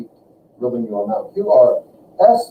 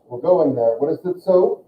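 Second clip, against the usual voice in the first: normal talking voice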